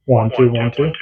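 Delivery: far-off radio speaker sound; counting heard through the walkie-talkie; tinny radio voice; radio playback. Real, not acted.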